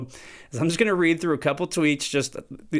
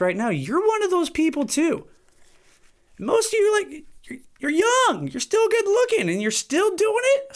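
incredulous scolding. Real, not acted. Scolding young listeners.

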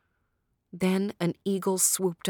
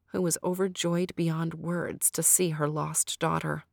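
plain reading voice

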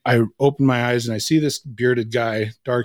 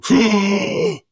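a high, sudden gasp of pain; sharp gasp; 10 semitones higher, 4 dB louder than usual. Retold or imitated. Waking to the chest tube, a high sharp gasp. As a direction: jolt awake with one sharp gasp.

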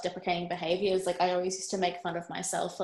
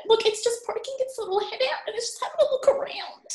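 excited; 14 semitones higher than usual. Real, not acted. Excited about rabbit outside.

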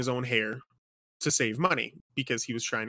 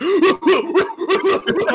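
high, loud shrieking laugh; real laugh that takes over; can't-breathe cackle; helpless laughter; 9 dB louder than usual. Real, not acted.

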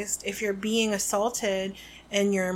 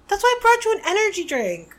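high, louder cheerful tease; upbeat teasing; 10 semitones higher, 5 dB louder, faster than usual. Real, not acted. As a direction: chirp it at a sleepy friend. Teasing sleepy co-host.

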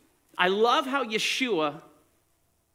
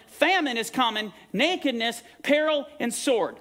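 higher-pitched pulpit warning; raised, emphatic preacher's voice; urgent preaching; 6 semitones higher than usual. Real, not acted. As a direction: declaim the list to the congregation.

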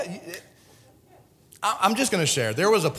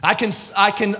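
ordinary talk, nothing marked

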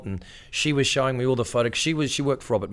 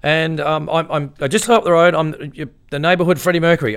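nervous; 8 semitones higher, 9 dB louder than usual. Retold or imitated. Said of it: "I was so nervous".

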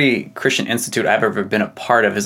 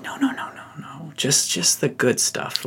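whispered, much softer, high no-no-no; mock hushed no; 4 semitones higher, 8 dB quieter than usual. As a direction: hush them like a nervous church. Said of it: "we're like".

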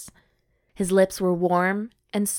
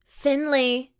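calling out; 7 semitones higher than usual. Acted. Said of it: "she called out".

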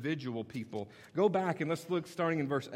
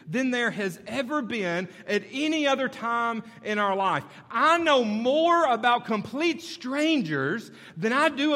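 higher and louder energetic sermon; animated pulpit talk; fired-up preaching; 8 semitones higher, 7 dB louder than usual. Acted. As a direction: push it out to the crowd with energy.

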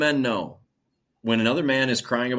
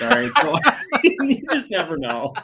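laughing; 10 semitones higher, 5 dB louder than usual. Real, not acted. Laughing at own crying story.

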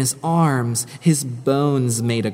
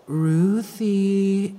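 "Ruthie" drawn out higher, in an eerie, airy ghost voice; ghostly hush; 6 semitones higher than usual. Acted. Ghost calling Ruthie from the dark in a hushed, eerie voice. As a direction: call "Ruthie" like wind through dry leaves. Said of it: "a hush like dry leaves".

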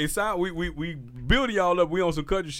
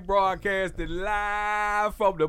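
hyped radio host announcing the show; radio DJ intro; 4 semitones higher than usual. Acted. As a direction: open it like a live radio DJ. DJ opening the show.

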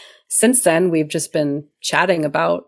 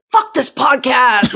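higher, slow mock rant; mock outburst; 6 semitones higher, 4 dB louder than usual. Friend mocking Rob's angry rant, high and slow. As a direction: ham up a fed-up friend quitting. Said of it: "it kinda came out a little bit like".

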